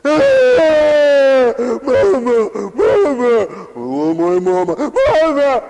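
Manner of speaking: loud, high mock wail; phony child's crying. Child in the nursery wails for mother, loud fake crying. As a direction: bawl like a manipulative little kid. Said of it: "all these phony cries".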